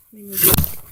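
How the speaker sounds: ordinary delivery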